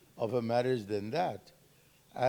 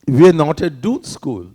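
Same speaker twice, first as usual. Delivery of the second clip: loud, alarmed announcement; loud exclaim; 18 dB louder than usual. Retold or imitated. Boy tells brother wrong school.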